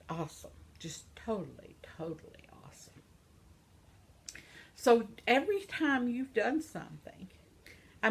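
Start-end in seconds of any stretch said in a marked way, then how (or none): 0.1-2.9 whispered wonder, fading; soft reverent whisper; awed whisper. Real, not acted. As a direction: breathe it out in awe.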